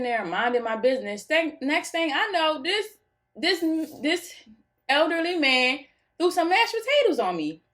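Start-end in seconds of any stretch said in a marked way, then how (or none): 1.2-7.6 high, slowed, stunned telling; can't believe he threw food at her; telling friends about the mashed potatoes, high and outraged; outraged disbelief. Real, not acted. Shocked at potatoes thrown on her.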